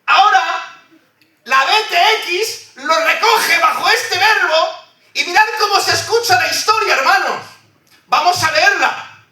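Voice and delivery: high, ringing preacher's cry, building emphasis; full-force preaching. Acted. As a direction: proclaim it to the congregation. Preacher announces the reading.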